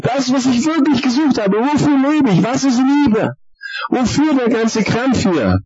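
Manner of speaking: impassioned high-pitched outcry to God; crying out. Retold or imitated. New believer calling out to God on finding what they sought, crying out. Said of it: "hab ausgerufen".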